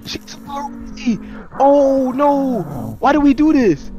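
high frightened wail; panicked. Real, not acted. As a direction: panic as the monster grabs you. Player grabbed by monster panics.

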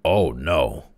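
heavy mock agreement; sarcastic, with a falling finish. A narrator's reading. Spotter scoffs at Crawley's denial, sarcastic. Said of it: "rejoined the spotter sarcastically".

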